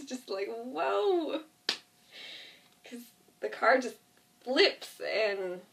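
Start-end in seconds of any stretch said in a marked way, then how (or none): 0.5-1.4 high falling whoa of amazement; amazed whoa. Retold or imitated. Describing the crash scene, a high amazed whoa.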